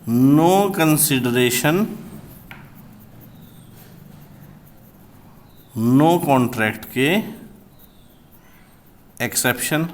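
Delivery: very slow dictation. Shown as a demonstration. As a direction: dictate each word slowly for notes. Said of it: "हम पढ़ रहे थे".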